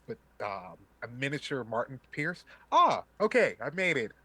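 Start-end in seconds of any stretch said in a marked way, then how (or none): 2.7-4.1 loud high relieved burst; excited relief. Acted. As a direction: gasp out relief at making it. Hologram Martin arrives breathless.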